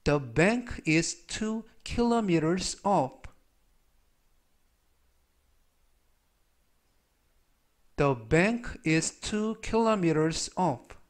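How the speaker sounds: very slow, careful English reading; slow, clear teacher's demo of an English sentence; slow model sentence. Shown as a demonstration. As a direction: spell out each word for the learners. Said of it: "라는 뜻이 되겠습니다".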